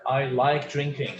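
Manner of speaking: ordinary talk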